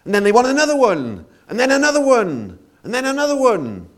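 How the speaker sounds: high, loud and quickening; building excitement. Acted. Preacher mocking crowds craving endless entertainment, high and rushing. Crowd wanting more acts.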